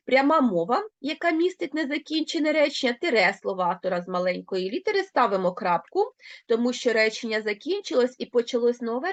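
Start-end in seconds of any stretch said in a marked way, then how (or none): none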